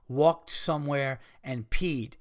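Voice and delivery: ordinary talk, nothing marked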